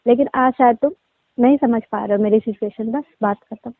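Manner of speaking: ordinary talk, nothing marked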